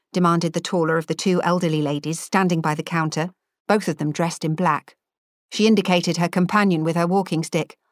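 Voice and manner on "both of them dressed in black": ordinary delivery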